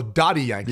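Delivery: "[name]" with Dottie's first vowel open, like "dot"; demonstrated pronunciation. Shown as a demonstration. Co-host shows the host how the artist's name is said, demonstrated pronunciation. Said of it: "how you say it again".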